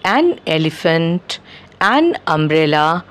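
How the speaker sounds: slowly spelled-out examples; slow example words. Shown as a demonstration. Teacher reading example words slowly to learners. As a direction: lay each example out slowly. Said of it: "an apple, an owl".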